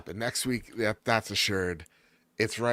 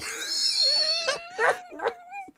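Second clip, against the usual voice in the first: clown laugh. Acted. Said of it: "The worst thing I've ever heard".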